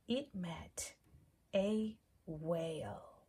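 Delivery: ordinary delivery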